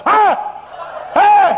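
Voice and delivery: very high, loud "hah" of the possessed man. Retold or imitated. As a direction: bark back like someone possessed. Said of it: "dia juga lebih keras". (geraman orang kerasukan, tinggi dan keras)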